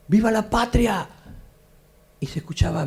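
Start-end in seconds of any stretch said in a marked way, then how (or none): 0.1-1.1 battle cry. Retold or imitated.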